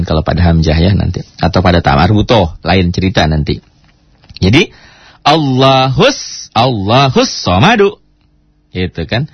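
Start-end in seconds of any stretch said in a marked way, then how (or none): 5.2-8.0 slow Allahus somadu, each beat jerked; jerked drill. Shown as a demonstration. Teacher shows the jerk pupils must learn first. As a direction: stamp out Allahus somadu in hard beats. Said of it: "belajar nyentak seperti itu".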